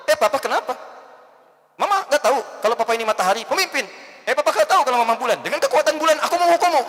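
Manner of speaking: fast, high and loud comeback, laughing at the end; sassy wife. Acted. Wife fires back at her husband: a fast, high, loud, laughing retort. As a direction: snap back playfully like a cartoon heroine.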